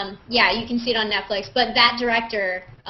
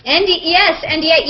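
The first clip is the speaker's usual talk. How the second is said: playful confirm; 6 semitones higher, 6 dB louder than usual. Real, not acted.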